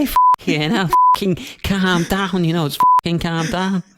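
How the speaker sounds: put-on Scouse, telling someone to calm down; Scouse accent. Co-host tells the waiting lads to calm down, Scouse accent. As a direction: exaggerate a sweary Scouse accent. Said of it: "you could do a Scouse accent".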